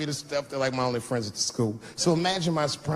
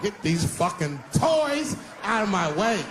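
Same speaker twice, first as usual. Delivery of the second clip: slow, hammed-up yell; naked dad shouting to clear the toys, slow yell; mock shout; 7 semitones higher, slower than usual. Dragged-out bellow.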